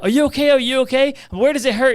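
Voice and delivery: worried dad. Retold or imitated. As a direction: rush to your son in alarm. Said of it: "And I'm like".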